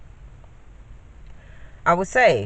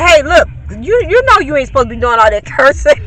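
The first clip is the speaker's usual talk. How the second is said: scolding neighbor; 6 semitones higher, 7 dB louder than usual. Retold or imitated. Neighbor calls out to kids walking home, loud scolding. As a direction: call out to stop the cursing kids.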